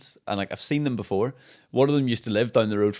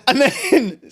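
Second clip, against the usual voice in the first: high laughing confession; amused high laughing aside; 10 semitones higher, 7 dB louder than usual. Real, not acted. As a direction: laugh through the admission. Laughing at own awkwardness.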